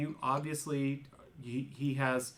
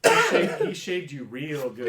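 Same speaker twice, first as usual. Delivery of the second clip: laughing; 7 dB louder than usual. Real, not acted. Group laughing at shaved character.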